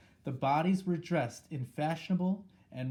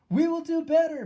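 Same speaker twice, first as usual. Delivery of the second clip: much higher, louder reply of the witches; a crowd of witches eager to please; murmured reply; 10 dB louder than usual. A narrator's reading. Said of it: "murmured the audience".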